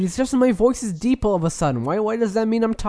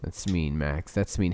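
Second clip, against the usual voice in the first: low, soft hurt sulk; mock hurt; 13 semitones lower, 7 dB quieter than usual. Host sulks at his co-host, low and soft. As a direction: sulk it like a wounded kid.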